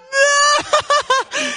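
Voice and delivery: high laughing disbelief. Retold or imitated. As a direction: cry out amazed, laughing. Own shocked laughing exclamation.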